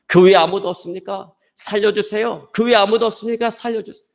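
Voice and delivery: high, pleading cry up into the dark; calling for help. Retold or imitated. Fallen climber calls up the cliff for help, acted desperate shout. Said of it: "소리치기 시작했습니다".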